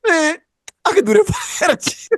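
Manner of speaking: high, put-on whiny boast; mock a whining braggart; hammed-up whiny bragging friend. Imitating friend's bragging whine.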